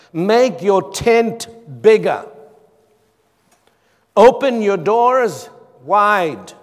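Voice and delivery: loud, slow emphatic reading; high, forceful preacher's declamation; scripture proclamation. Acted. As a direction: declaim each word big, with long pauses. Reads plainer translation aloud.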